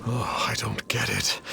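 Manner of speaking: hushed, breathy aside to himself; puzzled whisper. Acted. Star Snatcher whispering, baffled his sigil failed.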